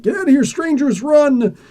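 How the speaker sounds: high-pitched villagers calling out in alarm; hammed-up fleeing crowd; mock panicked cry.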